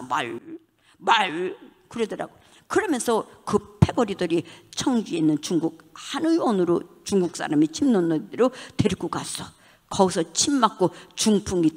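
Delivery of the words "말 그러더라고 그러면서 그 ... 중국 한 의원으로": usual speaking voice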